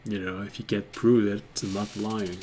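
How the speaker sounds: quiet, low-key goblin playing innocent; sly goblin. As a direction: deny the theft softly, as the goblin. Game master voices the goblin.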